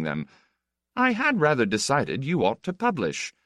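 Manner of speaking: a touch of the worldly Count, airy and teasing; lightly voiced flippant Count. The Count teases a guest about publishing, lightly flippant.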